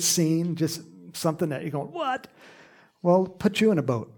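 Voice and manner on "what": startled what. Pretend. Listener's stunned reaction.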